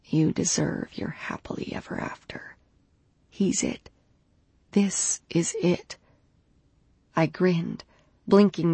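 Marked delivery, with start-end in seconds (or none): 0.0-6.0 soft breathy whisper. A narrator's reading. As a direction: whisper it gently. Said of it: "before whispering".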